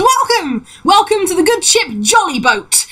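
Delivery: high, exuberant halfling greeting; excited welcome. Acted. Halfling captain greeting new passengers, excited high voice. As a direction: welcome them with a high, theatrical flourish. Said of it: "in the air excitedly and says".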